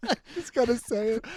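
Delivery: ordinary talk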